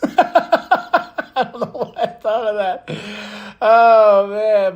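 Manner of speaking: slow, laughing through the words; real amused laughter. Real, not acted. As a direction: giggle at your own pun. Laughing at own joke.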